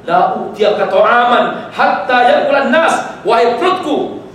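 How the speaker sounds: slow, loud Arabic vow in a lightly voiced Umar; a hint of a resolute caliph swearing; stern vow. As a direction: Umar vows to his own hungry stomach, firmly. Umar addresses his stomach.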